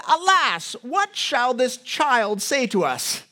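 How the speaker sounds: high, slow, hammed-up crone; witchy old woman. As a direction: wail it slowly like a cantankerous witch. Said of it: "She sounds a bit like a witch".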